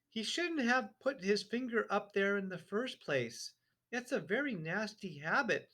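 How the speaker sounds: high, prim wifely voice; a fussy wife brushing him off; wife's voice. A narrator's reading. Wife dismissing husband's hat trouble, high and prim. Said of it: "said Missus Wormwood".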